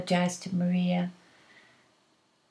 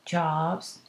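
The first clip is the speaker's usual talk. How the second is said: ordinary talk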